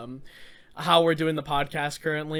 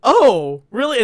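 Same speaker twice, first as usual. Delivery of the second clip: louder, rising "really" in mock surprise; imitated surprise; 4 semitones higher, 7 dB louder, slower than usual. Retelling Jordan's reaction, mildly surprised. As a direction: act caught off guard. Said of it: "genuinely, like, surprised at this".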